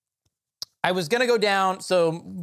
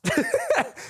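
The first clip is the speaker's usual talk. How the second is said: sudden high burst of laughter. Real, not acted. Laughing at coworker's line.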